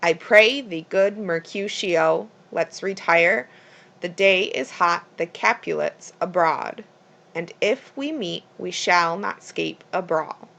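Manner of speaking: every second syllable stressed, as in "I PRAY thee GOOD"; iambic line reading. Shown as a demonstration. Teacher reading from Romeo and Juliet, iambic stress from "I PRAY" to "a BRAWL". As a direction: read "I pray thee, good Mercutio" in measured beats, weak then strong. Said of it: "would read".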